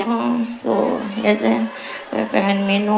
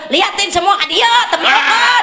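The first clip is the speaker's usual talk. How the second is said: playful call out; 7 semitones higher than usual. Acted. Preacher acts out a wife calling her friends, high playful call. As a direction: call across the room to your friends.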